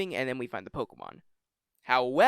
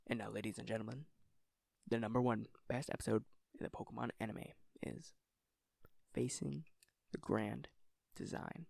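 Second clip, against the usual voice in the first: game-show build-up, softer and pausing; dramatic reveal; 4 semitones lower, 12 dB quieter than usual. Pretend. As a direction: drop the voice and drag out the big reveal. Announcing the number one pick.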